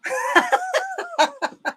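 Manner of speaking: high squealing laugh. Real, not acted. Laughing at her own mailman story, high squeal.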